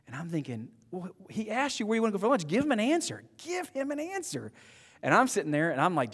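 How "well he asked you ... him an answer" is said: high, strained mock pleading; exasperated. Young man silently urging the guys to answer, high exasperated squeak. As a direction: imitate an inner outburst, climbing higher on the repeat. Acted exasperated thought at evasive friends.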